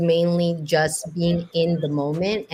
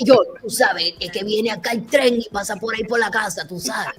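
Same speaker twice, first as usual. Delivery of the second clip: a hint of a woman with a cigarette in hand; lightly voiced neighbourhood lady gossiping; light Caribbean Spanish lady voice; smoky woman; 3 semitones higher than usual. Shown as a demonstration. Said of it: "Like a woman with a cigarette in her hand".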